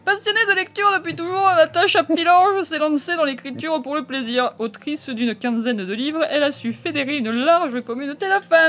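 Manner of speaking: high, projected announcer-style reading; hammed-up bio read. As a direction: sing out the author bio with mock grandeur. Reads author bio theatrically.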